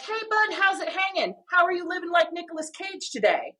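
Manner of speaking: high, bright-eyed hey bud to a buddy; upbeat greeting. Retold or imitated. Host greeting her cheerful friend George, upbeat and high. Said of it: "I'm like".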